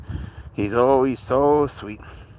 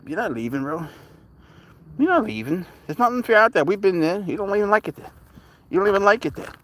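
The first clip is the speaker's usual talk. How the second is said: raised sing-song voice to the cat; high pet talk; 8 semitones higher, faster than usual. Retold or imitated. Owner coaxes his cat not to wander off, in high pet talk. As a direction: coax the cat like a baby.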